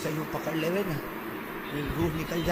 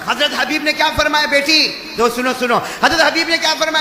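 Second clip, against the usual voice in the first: loud, high question-and-answer retelling; fervent preaching; 9 semitones higher, 11 dB louder than usual. Acted. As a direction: drive the dialogue home at full voice. Preaches at full force. (بلند، جوشیلی خطابت)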